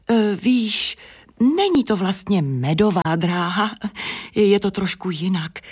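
plain reading voice

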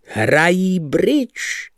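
high, very slow mocking "bridge"; scornful sneer. Lebeda sneering at the card game, in a slow, high mocking voice. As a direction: drag out the word in contempt.

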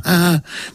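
a quick high pleased chuckle; light amused laugh; short chuckle. Acted. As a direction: laugh with pride at the pupil.

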